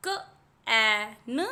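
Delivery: म ए न, each sound split apart and slow; sounding out letters. Shown as a demonstration. Teacher sounding out a word for children, slow and split. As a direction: stretch म ए न into separate sounds. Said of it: "तो यहाँ पर क एट".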